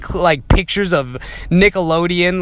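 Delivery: ordinary talk, nothing marked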